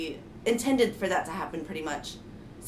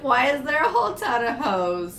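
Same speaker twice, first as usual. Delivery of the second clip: puzzled; 4 semitones higher, 4 dB louder than usual. Retold or imitated. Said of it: "I was like".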